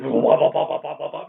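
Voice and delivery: loud burst of chopper "wah-wah"; imitated helicopter noise. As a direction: mimic the thudding rotor, loud at first. Imitating a police helicopter overhead.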